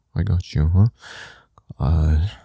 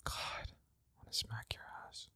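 whispering; 6 semitones higher, 25 dB quieter than usual. Acted. Intimate whispered audio.

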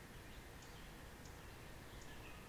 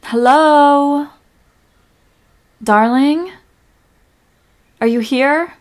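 raised, searching call; loud questioning call, pitched up; 5 semitones higher, 8 dB louder, slower than usual. Acted. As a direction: call into an open space for your partner. Searching the park for partner.

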